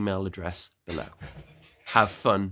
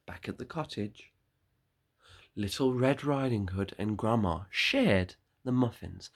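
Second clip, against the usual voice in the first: ordinary delivery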